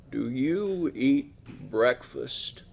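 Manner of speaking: put-on very slow, soft, frail old man voice; slow quavering elder asking a question. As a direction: drag each word out like a tired old man. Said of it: "He talks slow".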